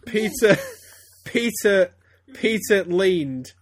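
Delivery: high, loud restarts through the giggles; real laughter pushing the voice up; fighting laughter. Real, not acted. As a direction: struggle to get back to reading after cracking up. Restarting after bursting out laughing.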